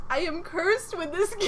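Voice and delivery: laughing through cursed with this game; game said through laughter. Real, not acted. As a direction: repeat it cracking up.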